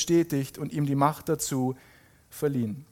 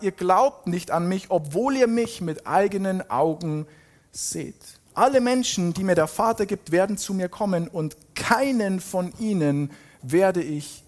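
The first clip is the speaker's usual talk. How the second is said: higher, deliberate proclamation, dropping at phrase ends; dramatic scripture reading; 5 semitones higher than usual. Acted. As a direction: proclaim each promise with weighty pauses. Reads Jesus' speech emphatically. (eindringlich verkündende Bibellesung)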